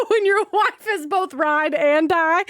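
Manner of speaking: slow punchline through laughter; words breaking into a real laugh. Real, not acted. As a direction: draw the joke out while cracking up. Cracking up at her own joke.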